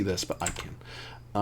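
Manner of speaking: a low, teasing whisper. Acted. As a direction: whisper it like a secret. GM teasing players with secret.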